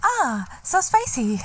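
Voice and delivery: high, slow breathy food reviewer; ASMR mukbang voice. Pretend. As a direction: coo over spicy food. Mock TikTok ASMR girls.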